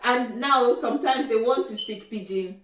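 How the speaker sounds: normal talking voice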